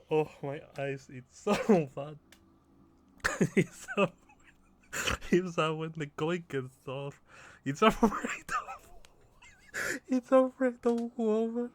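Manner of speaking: words climbing high and loud through real laughter; uncontrollable laughter. Real, not acted. Player reading absurd game text, in hysterics. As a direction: keep breaking down laughing as you read.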